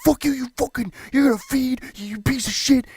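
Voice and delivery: hammed-up high furious yell; mock angry rant. Gamer imagines flaming his teammate, hammed-up high furious yell. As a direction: blow up at the teammate in a put-on rage. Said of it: "I'm not gonna be the guy like".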